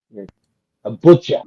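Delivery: normal talking voice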